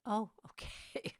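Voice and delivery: put-on a sceptical teen shrugging it off, whispered; mock dubious whisper; breathy, slow, unimpressed teenage okay. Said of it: "I went".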